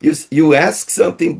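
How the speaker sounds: normal talking voice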